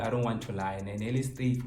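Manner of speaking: normal talking voice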